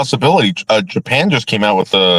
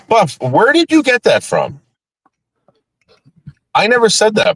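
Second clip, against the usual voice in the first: much higher, outraged disbelief; incredulous denial; 10 semitones higher than usual. Real, not acted. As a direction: protest in high disbelief. Shocked denial to chat.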